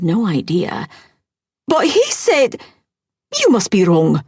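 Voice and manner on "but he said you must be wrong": high, loud stepmother disbelief; shocked denial. Acted. Stepmother refusing to believe who paid, high and loud. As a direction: jump way up on "he", then insist.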